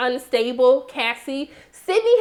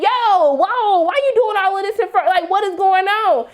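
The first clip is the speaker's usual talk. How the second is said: loud, high imitated objection; alarmed protest; 7 semitones higher, 4 dB louder than usual. Sons stopping dad's drunken monologue, alarmed protest. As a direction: call it out high and loud, appalled.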